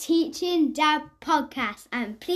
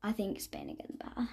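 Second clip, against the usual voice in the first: breathy fast whisper, much softer; hushed and quick, almost under the breath; 6 semitones lower, 13 dB quieter, faster than usual. Real, not acted. Boy whispers his pick.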